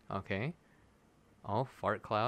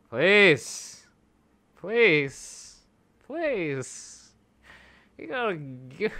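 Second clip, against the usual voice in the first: high, drawn-out pleading; begging; 6 semitones higher, 5 dB louder, much slower than usual. Real, not acted. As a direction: beg the game for mercy. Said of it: "gonna try to stop begging".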